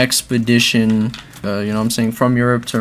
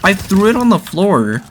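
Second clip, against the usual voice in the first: indignant complaint; 9 semitones higher, 4 dB louder than usual. Real, not acted. Outraged at game.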